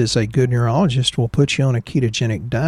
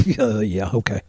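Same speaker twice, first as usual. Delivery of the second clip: mock exhale after a long cigarette drag; imitating a smoker; 3 semitones lower, 4 dB quieter than usual. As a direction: play a smoker answering through the puff. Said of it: "took a long drag off his cigarette".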